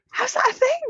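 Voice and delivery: high, incredulous question; can't believe it; playful disbelief. Real, not acted. Amused disbelief at guinea pigs.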